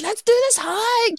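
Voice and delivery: put-on high, slow drunken slur; mock tipsy battle cry; drunk friend. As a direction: imitate a drunk, overkeen travel buddy. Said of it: "she was still drunk at six A M".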